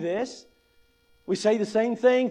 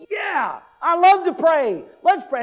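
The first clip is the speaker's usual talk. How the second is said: eager congregation; 7 semitones higher, 6 dB louder than usual. Pretend. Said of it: "everybody would go".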